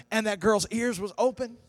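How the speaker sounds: high, loud preaching climax; full-force preaching. Acted. Pastor driving home faith to the congregation, fervent. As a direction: preach it to the rafters.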